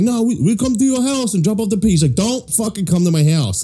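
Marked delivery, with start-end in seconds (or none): 0.0-1.9 much higher insistent voice; imitated pushy client. Russian couple insisting to his boss, imitated pushiness. 2.2-3.6 high-pitched, slow irritated refusal; imitated angry boss. Boss warning clients off his house, imitated annoyance.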